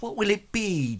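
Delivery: put-on sweet serving voice; fawning barmaid. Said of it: "looks at Brian and says".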